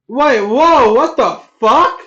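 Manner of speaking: loud, high, stunned outburst; shocked outburst. Real, not acted. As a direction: blurt it out in disbelief. Streamer reacts in shock.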